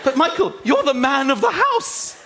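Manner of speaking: lightly voiced insistent wife; mock wife insisting. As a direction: push him to be brave. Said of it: "wife's voice".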